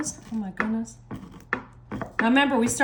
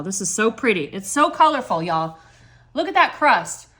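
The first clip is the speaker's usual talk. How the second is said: admiring; 4 semitones lower, 5 dB louder than usual. Real, not acted. Admiring her dessert.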